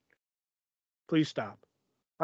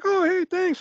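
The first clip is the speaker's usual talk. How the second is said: very high imitated phone voice; exaggerated chirpy reply; mock cheery thanks; slower than usual. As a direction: imitate a friend pleased by the call. Imitates friend answering birthday call.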